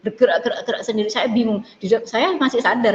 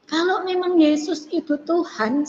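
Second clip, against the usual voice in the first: high, slow plea, unshouted; retold inner cry; 7 semitones higher, slower than usual. Woman asking God for proof, high retold cry. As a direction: stretch it out like a heartfelt challenge to God. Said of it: "kayak seperti teriak gini".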